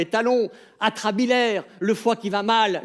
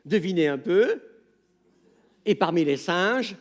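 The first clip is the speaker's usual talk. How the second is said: ordinary talk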